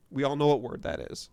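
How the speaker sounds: ordinary talk, nothing marked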